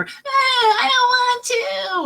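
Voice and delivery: put-on high, slow whining child; hammed-up whining child; whiny child. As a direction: drag out a sulky kid's whine. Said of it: "Instead of being like".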